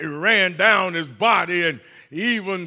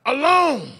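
loud, higher, emotional "leave her alone"; preacher shouts the rebuke to the congregation; emphatic command; 8 semitones higher, 5 dB louder, slower than usual. Acted. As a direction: tell them off with feeling. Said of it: "you tell them".

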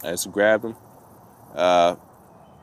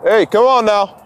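calling out; 11 semitones higher than usual. Real, not acted. Calling dog from far.

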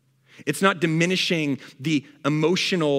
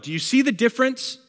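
high emphatic question; 10 semitones higher, 4 dB louder than usual. Real, not acted. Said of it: "preacher asks congregation".

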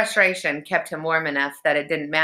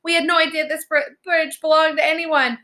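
put-on high, anxious girl's voice; much higher, apologetic; frightened pleading; 12 semitones higher, 3 dB louder than usual. A narrator's reading. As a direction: plead innocence to a monster. Frightened Alex pleads with troll.

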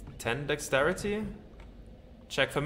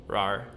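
dinosaur growl; 5 semitones lower than usual. Pretend. Dinosaur answering Charles, low growl.

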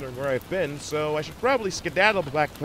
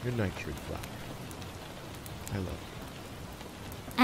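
a slow, breathy goodnight whisper, much softer; 8 semitones lower, 8 dB quieter, slower than usual. Acted. Norby whispers goodnight love to Treeflower, very slow and hushed. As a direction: breathe it to her as you drift off.

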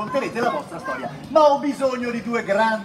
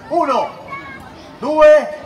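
counting up to three, each number louder and higher; 6 semitones higher, 7 dB louder, much slower than usual. Shown as a demonstration. Host cueing the children to shout the sheep's name, building count. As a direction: cue the crowd with a big, rising count. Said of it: "Al, al mio tre".

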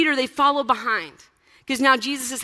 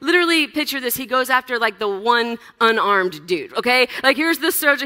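ordinary delivery

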